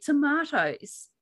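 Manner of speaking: "tomatoes" said toe-MAH-toes; "tomatoes" with an Australian ah vowel. Shown as a demonstration. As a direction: give "tomatoes" the broad Aussie ah. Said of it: "in Australia".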